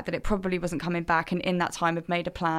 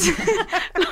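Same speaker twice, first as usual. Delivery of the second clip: relieved laugh; 11 semitones higher than usual. Retold or imitated. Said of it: "Like, crying on the phone".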